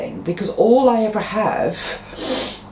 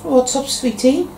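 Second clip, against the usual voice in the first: higher, sweet voice for the cat; pet talk; 8 semitones higher than usual. Real, not acted. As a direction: coo at a pet. Talking to his pet cat.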